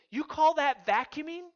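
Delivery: high, loud, incredulous challenge; imitating a wife's outraged jab; scornful wife. Wife criticises husband's vacuuming, scornful.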